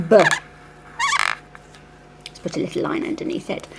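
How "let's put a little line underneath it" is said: whispered aside. Real, not acted. Teacher aside while writing.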